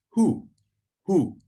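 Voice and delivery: "who" with the oo of crude; vowel demo. Shown as a demonstration. Teacher modelling Hebrew for he, "who" with long oo. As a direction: repeat "who" apart, with a pure oo. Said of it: "like the oo in crude".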